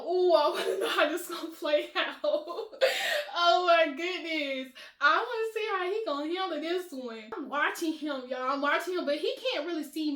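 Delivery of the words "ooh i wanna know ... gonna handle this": laughing through the words, gleeful; tickled by the drama; amused giggling. Real, not acted. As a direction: let giggles break into the line. Giggling over the unfolding drama.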